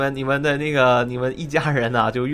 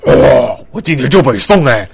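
excited outburst; 8 dB louder than usual. Retold or imitated. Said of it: "他可能就是".

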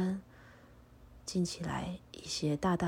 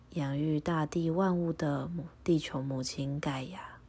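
prayer recitation. Acted. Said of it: "请和我一起复诵一下祈祷文".

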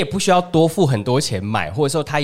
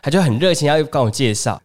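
ordinary delivery

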